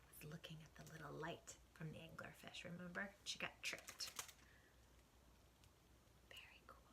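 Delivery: soft hushed aside; whispered aside about Dory. Real, not acted. Hushed aside to child viewers.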